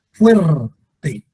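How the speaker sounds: "fuerte" split, FUER long and strong; FUER... te, weight on the first; stress on first syllable. Shown as a demonstration. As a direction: punch FUER, let "te" drop in "fuerte". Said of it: "la sílaba tónica es fuerte".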